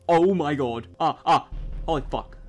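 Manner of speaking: loud panicked outburst; startled yelling. Real, not acted. Gamer yelling as animal attacks. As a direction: yell in real fright.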